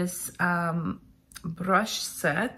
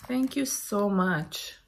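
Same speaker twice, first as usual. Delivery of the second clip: usual speaking voice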